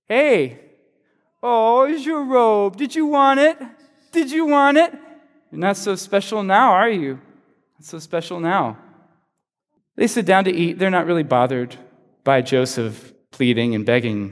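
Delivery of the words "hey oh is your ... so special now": high, sneering taunt at the stripped brother; high, mocking singsong taunt. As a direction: jeer at him like a cruel older brother. Brothers taunting Joseph at the pit.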